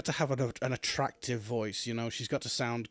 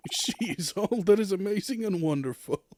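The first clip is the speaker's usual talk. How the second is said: high, excited showbiz announcer; hype announcer; 6 semitones higher than usual. Acted. Host hyping the crowd before the star enters, excited announcer voice. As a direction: build the crowd up for her entrance.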